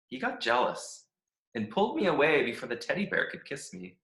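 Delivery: upbeat, chirpy diary reading; a happy-go-lucky stick who loves every minute; cheerful Stick. Acted. Stick reads his cheerful diary.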